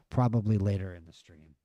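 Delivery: ordinary talk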